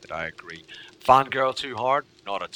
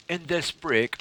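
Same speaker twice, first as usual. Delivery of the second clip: ordinary delivery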